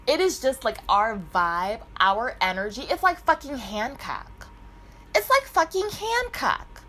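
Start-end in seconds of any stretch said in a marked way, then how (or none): 5.2-6.8 excited repeat. Real, not acted. Repeats line emphatically.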